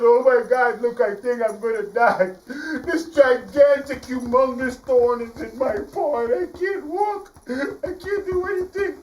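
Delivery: high, slow dramatic moaning; lion whining to the mouse; whining hurt lion. Acted. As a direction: moan about the thorn. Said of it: "the lion said".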